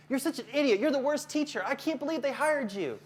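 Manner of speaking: high-pitched mock tirade; angry parent. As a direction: berate the teacher as an irate parent. Acted angry parent insulting a teacher.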